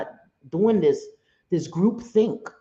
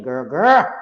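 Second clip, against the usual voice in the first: loud, urgent check on a friend; mock scolding shout; imitated warning call; 3 semitones lower, 7 dB louder than usual. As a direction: pull her up short, louder on the repeat. Imitates Skippy pulling her up.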